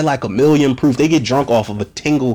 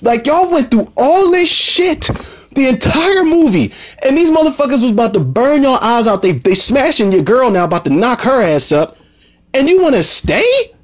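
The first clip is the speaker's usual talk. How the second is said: outraged disbelief; 9 semitones higher than usual. Retold or imitated. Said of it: "I'm like".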